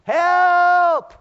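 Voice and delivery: full-out high shout; loud, high-pitched holler; yelled cry for help. Acted. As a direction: scream it at the top of your voice, one long held word. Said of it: "Have you ever yelled".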